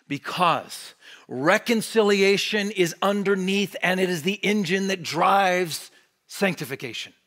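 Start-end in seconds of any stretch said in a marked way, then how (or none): none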